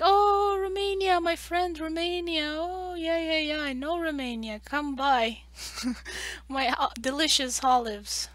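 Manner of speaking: high, loud eager street-vendor call; Turkish shopkeeper. Retold or imitated. Shopkeeper greeting a Romanian tourist, high and eager. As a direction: hawk your wares to a passing tourist, high and eager. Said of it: "they're like".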